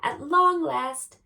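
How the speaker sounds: high, loud, slow proclamation; triumphant. A narrator's reading. Storyteller announcing the dragon tamed at last, triumphant. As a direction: proclaim it slowly and grandly.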